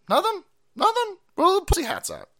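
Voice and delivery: very high, sneering nothing?; high mocking rhetorical question; mocking disbelief. Real, not acted. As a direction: taunt the silence in a squeak.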